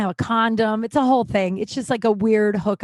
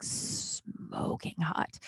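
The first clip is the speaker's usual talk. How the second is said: whispered; 4 semitones lower, 13 dB quieter, much slower than usual. Real, not acted. Comic gushing about the hot guy, whispered. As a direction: drop to a whisper on smoking hot.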